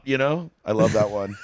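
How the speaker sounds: ordinary talk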